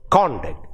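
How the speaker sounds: CONduct, strong first syllable, falling; first-syllable stress. Shown as a demonstration. Teacher contrasting noun and verb, first-syllable stress on conduct. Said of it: "first syllable".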